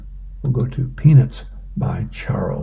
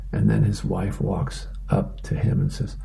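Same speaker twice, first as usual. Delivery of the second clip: normal talking voice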